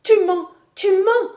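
very high, loud, desperate cry; distraught young woman crying out shrilly high; frantic denial. A narrator's reading. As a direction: repeat it as if losing your head. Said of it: "Alix dont la tête se perdait".

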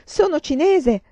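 loud, high desperate protest; grido supplichevole. A narrator's reading. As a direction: cry out, pleading with your captors. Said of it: "gridò infine". (grido acuto di supplica)

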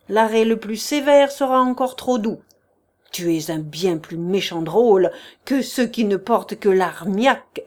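loud, stern, building scorn; furious condemnation. Acted. Prince condemns Chichikov to prison, loud and scornful. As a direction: crush him with contempt.

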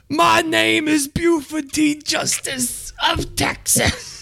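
high, slow Southern sheriff drawl imitation; Buford T. Justice. Imitating the sheriff introducing himself, high and slow. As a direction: ham up the pompous Texas sheriff, slow and high. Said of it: "Jackie Gleason in that movie".

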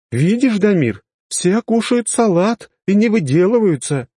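high, slow pointed mother voice; mother lecturing. Acted. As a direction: teach the boy a lesson.

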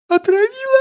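put-on very high childish question; sleepy little girl. A narrator's reading. Yawning child asking back, sleepy squeak. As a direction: ask it drowsily in a tiny voice. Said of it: "зевая переспросила малышка".

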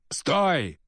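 sharp high shout of alarm; exclaiming. Acted. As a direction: cry out to stop him. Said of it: "воскликнул мандалорец".

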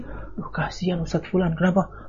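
soft, sighing fake sympathy; mock pity. Preacher imitating a gossip, soft fake sympathy. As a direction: pity that carries a hidden jab, murmured. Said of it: "tapi sepertinya simpati".

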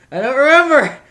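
loud, frustrated outburst; exasperated shout. Real, not acted. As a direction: yell it out in frustration. Frustrated at forgetting topic.

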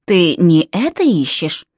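low, slow, dry butler voice. A narrator's reading. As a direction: offer it coolly, like a butler. Butler Jalabin speaks, one line.

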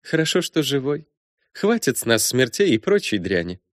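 high, brisk, bossy woman; brash princess voice. Acted. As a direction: play her as a bold, teasing princess. Matilda voiced by narrator.

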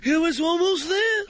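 Jerry Seinfeld impression. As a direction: squeal it like a stand-up comic. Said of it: "Jerry Seinfeld having sex".

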